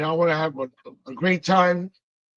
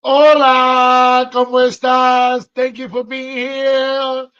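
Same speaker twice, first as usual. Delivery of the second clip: excited; 5 semitones higher, 8 dB louder, slower than usual. Real, not acted. Greeting a viewer excitedly.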